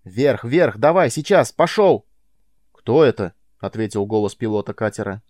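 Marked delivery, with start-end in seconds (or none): 0.1-2.1 yelling urgent commands; shouting. A narrator's reading. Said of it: "крича в него". 2.7-3.3 pilot over the radio. Acted. Pilot answers over helmet.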